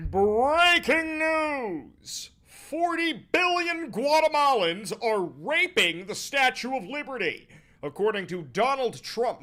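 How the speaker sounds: loud, high-pitched hammed-up news alarm; mock breaking news. Streamer faking breaking news for his viewers, mock-alarmed shouting. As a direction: shout it like an absurd bulletin.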